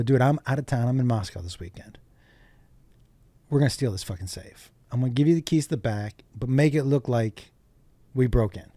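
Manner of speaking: low, quiet conspiratorial quote; hushed scheming. Retold or imitated. Retelling the safe plot to Joey, hushed scheming. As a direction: let him in on the plan, softly.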